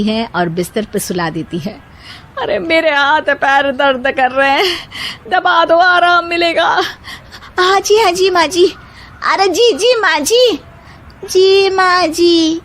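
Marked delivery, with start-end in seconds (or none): 2.4-6.7 put-on high, complaining old woman voice; aching mother-in-law. A narrator's reading. Mother-in-law asks bahus to press her aching limbs. 7.6-12.6 obedient bahus. A narrator's reading. Bahus eagerly agree to massage mother-in-law, high sweet voices.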